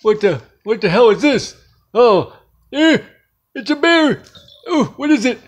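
high, jumpy exclamations; startled alarm. Acted. Finding an unexpected bear in the room, startled. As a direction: blurt it out high, spooked.

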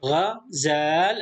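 غزال drawn out slowly, every sound clear; teacher modelling غزال slowly; slow model reading. Shown as a demonstration. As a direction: read غزال syllable by syllable for the class. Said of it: "ثم نقرأ هنا".

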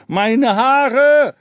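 high banshee wail in country dialect; loud, high howl of pain. A narrator's reading. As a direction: yelp long and loud in a rustic accent. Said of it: "der wie eine Totesfee heulte".

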